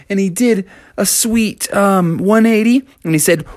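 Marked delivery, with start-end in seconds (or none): none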